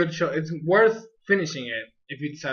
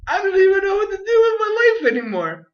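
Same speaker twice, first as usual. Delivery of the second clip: much higher, louder, in hammed-up despair; despairing wail; 13 semitones higher, 5 dB louder than usual. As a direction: wail like life has lost its meaning. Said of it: "and I'm like".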